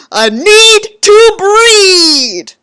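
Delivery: slow, high, loud title cry; dramatic shout. Acted. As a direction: belt the chapter title like a melodrama. Dramatic chapter title announcement.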